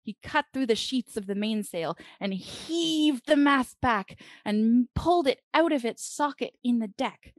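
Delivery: ordinary delivery, nothing marked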